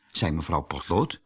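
usual speaking voice

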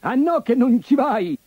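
high shrieking ogre; shrieking orc. Acted. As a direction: screech like an ogre. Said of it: "strillò l'orco".

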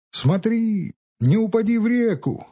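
high call after him. A narrator's reading. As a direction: shout a warning after someone. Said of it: "крикнул ему вслед Илюша".

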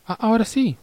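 sudden high relieved cry; excited. Real, not acted. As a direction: yelp with relief it works. Capture finally works.

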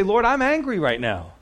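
a hint of frustrated pleading; honest angry prayer. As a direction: vent it to the Lord, lifted and pushed. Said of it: "it's okay to say".

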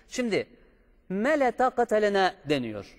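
usual speaking voice

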